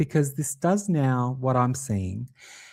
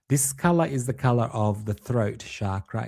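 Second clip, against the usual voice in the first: normal talking voice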